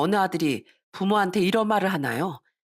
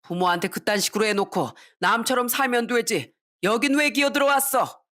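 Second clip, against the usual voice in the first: father yelling at a high pitch; angry outburst; 4 semitones higher than usual. Acted. Father confronts drunken son coming home, angry outburst. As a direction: lay into him straight away. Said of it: "다짜고짜 화를 냈어요".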